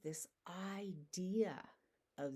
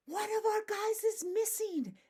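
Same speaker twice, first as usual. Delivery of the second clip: high, loud panicked cry; alarmed cry; 7 dB louder than usual. Acted. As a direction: sound the alarm, someone is gone. Count comes up one short.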